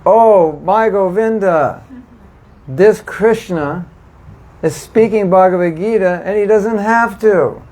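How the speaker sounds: high, slow, awed prayer of wonder; a touch of tearful devotion; devotional wonder. Retold or imitated. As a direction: marvel at Krishna with a rising, hushed awe. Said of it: "we could think".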